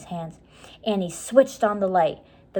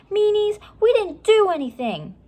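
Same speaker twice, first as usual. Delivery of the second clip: put-on much higher, louder girl's shout; 14 semitones higher, 6 dB louder than usual. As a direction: yell indignantly at the guards. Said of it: "Annie shouting at the guards".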